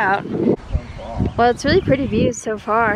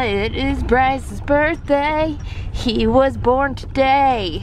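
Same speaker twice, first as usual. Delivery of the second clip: slow, drawn-out mock proclamation; playful birthday announcement; big announcement; 4 semitones higher, much slower than usual. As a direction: announce it like breaking news, slowly. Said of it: "really important news for everyone".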